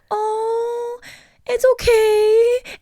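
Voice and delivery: high, drawn-out mock soothing; exaggerated singsong reassurance; imitated comforting. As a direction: coo it loud and slow, imitating a comforter. Imitating a sibling comforting her.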